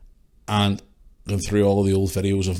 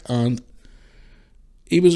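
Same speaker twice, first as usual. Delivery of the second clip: usual speaking voice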